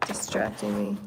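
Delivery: a quick whisper to herself; whispered aside. Real, not acted. Turning the page, she whispers to herself. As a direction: mutter it away under her breath.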